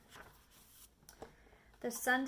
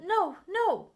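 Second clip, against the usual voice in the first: higher, louder alarmed no; fish protesting; 6 semitones higher, 8 dB louder than usual. Acted. The fish alarmed, urging the children, raised and loud. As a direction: cry out in alarm like a fretful fish. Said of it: "But our fish said".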